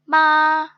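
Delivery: मा held long, high and perfectly level; first-tone मा, steady with no rise or dip; flat first tone. Shown as a demonstration. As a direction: sustain मा straight on one high pitch, loud. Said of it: "flat tone".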